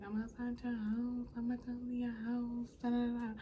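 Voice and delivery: mock his repeated claim; mocking singsong. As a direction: chant it like an empty threat. Mocking a claim always made.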